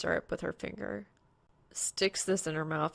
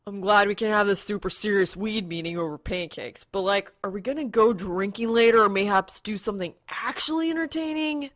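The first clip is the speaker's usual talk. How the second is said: loud, brash, higher party-guy voice; loud brash Blackstar; 3 semitones higher, 8 dB louder than usual. A narrator's reading. Guildmate pushing for Vegas nightlife, in a loud brash voice. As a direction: needle the table, demanding fun.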